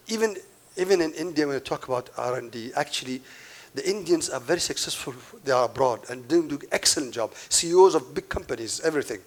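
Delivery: normal talking voice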